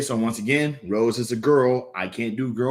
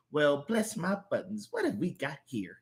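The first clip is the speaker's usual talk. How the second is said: soft granny curiosity; folksy grandma; 6 semitones higher, 6 dB quieter than usual. Acted. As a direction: size up the stranger softly. Plays amused old Grandma.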